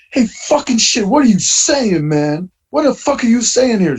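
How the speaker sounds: high, agitated retold yell, pitched well up; baffled outburst. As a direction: act out shouting at a confusing caller. Confused outburst at phone caller.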